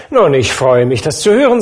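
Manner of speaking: delighted host beaming at the table; bright jovial nephew; cheerful nephew. Acted. Nephew pleased.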